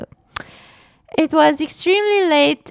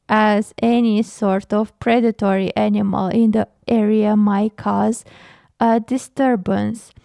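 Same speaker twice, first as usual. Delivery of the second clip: ordinary delivery, nothing marked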